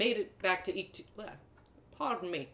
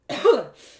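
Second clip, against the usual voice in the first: acted cough; 6 semitones higher, 14 dB louder than usual. Narrator coughs on drink.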